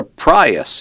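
Prius with a long I, PRY-us; drawn-out Prius, said PRY-us. A narrator's reading. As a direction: say the first vowel of Prius like "eye". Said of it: "Long I like Prius".

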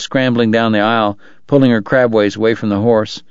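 plain reading voice

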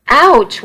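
high, sharp ouch in pain; pained yelp. Acted. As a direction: yelp it as the cane hits. Nana whacking Charlie with cane.

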